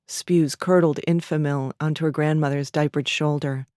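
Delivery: ordinary delivery, nothing marked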